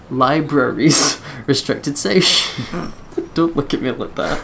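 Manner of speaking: ordinary delivery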